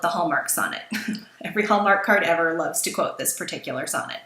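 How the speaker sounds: ordinary talk, nothing marked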